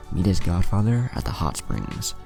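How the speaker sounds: usual speaking voice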